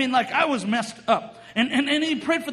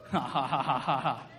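low, soft run of ha-has; low chuckling laugh; 12 semitones lower, 7 dB quieter than usual. Real, not acted. Preacher laughs after whooping.

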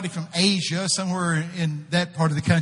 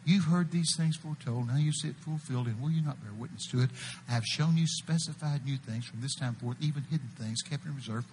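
lower, softer, faster reading; quiet reread; 5 semitones lower, 8 dB quieter than usual. Shown as a demonstration. Preacher rereading the verse to the crowd, quiet and low. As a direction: read it again calmly and low. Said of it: "I'll read it for you again".

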